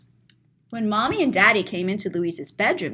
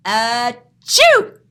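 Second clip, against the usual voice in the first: mock sneeze; 7 dB louder than usual. Mock sneeze to end video.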